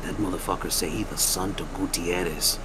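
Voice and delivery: low, soft retold warning; hushed confiding. Miguel pulls people aside to tell them, hushed. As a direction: drop it down as if tipping off friends.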